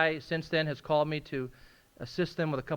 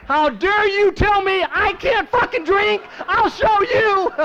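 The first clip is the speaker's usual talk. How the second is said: very loud and high, slowly spitting each word; his alcoholic head raging at the judge's ban; defiant inner rage; 10 semitones higher, 11 dB louder, slower than usual. Retold or imitated. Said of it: "my head said".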